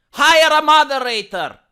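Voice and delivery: loud, exasperated instruction to a streamer; loud demand. Real, not acted. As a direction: bark the advice at her. Demanding she act.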